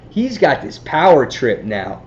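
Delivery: ordinary talk, nothing marked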